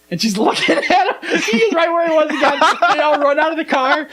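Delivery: high-pitched, cracking up mid-story; helpless laughing retelling; laughing while talking. Real, not acted. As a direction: tell it through real laughter.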